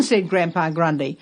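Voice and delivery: plain reading voice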